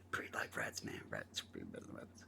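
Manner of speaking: hushed low aside; whisper. Real, not acted. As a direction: mutter it as a secret, very soft.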